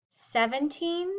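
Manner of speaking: ordinary talk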